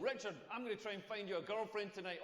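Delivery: ordinary talk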